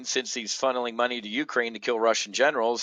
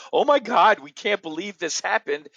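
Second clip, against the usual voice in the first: higher, louder mock gasp of disbelief; mock shock; 8 semitones higher, 4 dB louder than usual. Democrats reacting to nuclear war, hammed-up shock. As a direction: ham up the stunned Democrats. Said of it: "Democrats are going to be shocked, like".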